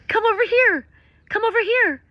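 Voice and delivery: high, loud excited call; thrilled calling to the sky. Real, not acted. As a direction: call the bird over. Calling to the condor.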